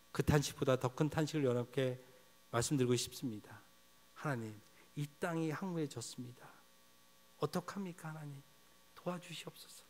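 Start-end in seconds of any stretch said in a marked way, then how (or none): 4.1-9.8 softly, pleading to God; a quiet lament, almost breaking; hushed plea. Pretend. Preacher voicing a prayer for the land, hushed and pleading. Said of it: "탄식하기를 원합니다".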